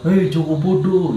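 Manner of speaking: high, slow taunting call; mocking voices. Voices in his head taunting the patient, slow high jeer. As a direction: taunt him like the voices did. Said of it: "Kayak bilang".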